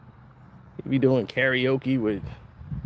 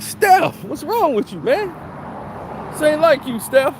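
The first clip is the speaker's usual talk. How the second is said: pleading fan; 12 semitones higher than usual. Retold or imitated. Fan confronting Steph at his house, high pleading whine. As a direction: whine at him like a betrayed fan. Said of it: "You're like".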